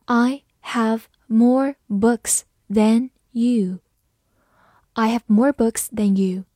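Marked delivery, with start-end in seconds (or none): 0.0-3.8 slow model sentence, pausing after each word; slow reading. Shown as a demonstration. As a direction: read slowly, one word at a time. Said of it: "慢慢来读". (慢速逐词朗读)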